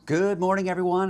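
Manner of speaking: cheery greeting. Real, not acted. Upbeat greeting opening the show.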